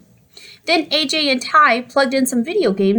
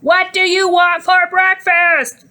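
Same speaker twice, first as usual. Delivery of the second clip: louder, higher, slow yell; 9 semitones higher, 4 dB louder, slower than usual. A narrator's reading. Mom yelling louder over the blaring TV. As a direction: yell it out, slowly and loud. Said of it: "Mom yelled".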